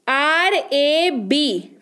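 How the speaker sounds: letters spelled out one by one, slowly; slow spelling. Shown as a demonstration. Teacher spells the word letter by letter, slowly. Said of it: "अब देखिए, यहाँ पर क्या हो रहा है".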